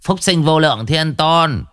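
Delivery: high, ringing ritual call; loud invocation. A narrator's reading. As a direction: proclaim it to the crowd. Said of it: "hô to".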